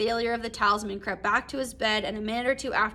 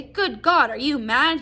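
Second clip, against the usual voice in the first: higher and louder, an aghast mock cry; 4 semitones higher, 7 dB louder than usual. A narrator's reading. Husband to his wife, a read-aloud aghast cry. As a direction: cry it out in horror, lightly performed. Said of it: "he cried aghast".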